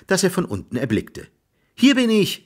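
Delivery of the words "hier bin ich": high, loud cry for attention; high-pitched call for help; shouting. A narrator's reading. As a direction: shout up from down a well. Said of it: "schrie Jack".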